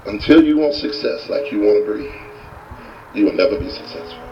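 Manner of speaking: low-pitched, grave pronouncement; deep, measured guru verdict; solemn lesson. Retold or imitated. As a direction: deliver the moral low and weighty. Guru delivers the story's lesson.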